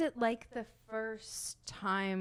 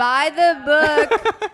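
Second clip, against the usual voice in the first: loud slow hype; 5 semitones higher, 12 dB louder, much slower than usual. Real, not acted. Hyping purchase.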